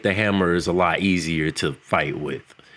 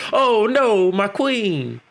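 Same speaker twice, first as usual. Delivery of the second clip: Thor's hammed-up, much higher cry of dismay; mock Thor; 14 semitones higher, much slower than usual. Thor pretending to mourn his queen, hammed-up high dismay. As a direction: overact a fake lament for his queen. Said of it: "because he's like".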